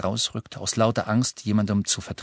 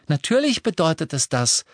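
higher, cheerful assurance; chirpy; 8 semitones higher, 3 dB louder than usual. A narrator's reading. Luna backs up Neville's hopes, chirpy. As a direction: answer brightly and breezily. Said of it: "sagte Luna munter".

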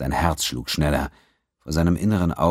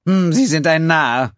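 high, loud and slow contempt; scornful; 13 semitones higher, 7 dB louder than usual. A narrator's reading. The accountant scorning the pirate captain, slow and contemptuous. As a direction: call him a fool to his face.

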